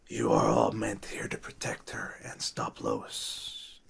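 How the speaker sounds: hushed, slow NPC whisper; whispering old lady. Acted. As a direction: breathe it like a secret warning.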